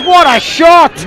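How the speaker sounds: excited shout. Real, not acted. Reacting to the big hit.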